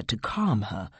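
plain reading voice

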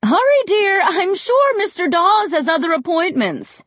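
calling after someone. A narrator's reading. Mom hurries her child upstairs, calling after her. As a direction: call up the stairs to hurry someone. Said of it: "Mom called after me".

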